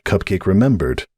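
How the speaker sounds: ordinary delivery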